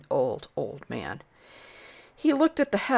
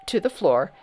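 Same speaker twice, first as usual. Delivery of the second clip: ordinary delivery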